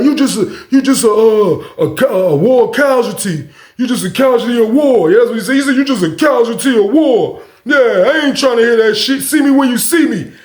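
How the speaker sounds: laughing, high taunting voice; mocking neighbor. Neighbor taunting him from his cell, laughing. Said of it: "He was like".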